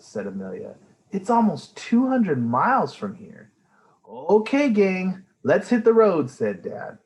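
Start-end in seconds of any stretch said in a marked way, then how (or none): 1.1-3.5 put-on child voice. Amelia voiced. 4.1-6.3 cheerful dad. Acted.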